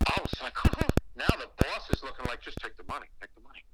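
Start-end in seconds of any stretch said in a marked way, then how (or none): 2.4-3.6 much softer, low insistent urging; hushed urging. Retold or imitated. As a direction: press him under your breath. Boss urging him quietly.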